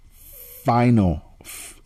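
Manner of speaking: "final" stretched out slowly and stressed; slow emphatic repeat. Real, not acted. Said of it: "I repeat".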